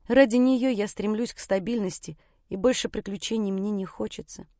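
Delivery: plain reading voice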